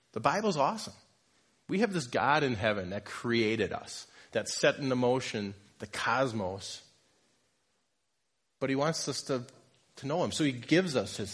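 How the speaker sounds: ordinary delivery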